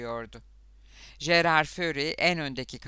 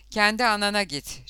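louder, higher angry retort; hissed rebuff; 7 semitones higher, 6 dB louder than usual. A narrator's reading. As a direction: snap it as a sharp brush-off. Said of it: "diye tısladı kız". (öfkeli, ters bir çıkış)

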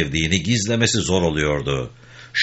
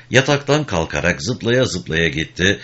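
usual speaking voice

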